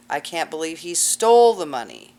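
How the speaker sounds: "stole" punched loud and then falling; stress on STOLE. Shown as a demonstration. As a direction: hit the word "stole" hard and loud. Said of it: "everybody emphasizing a different word".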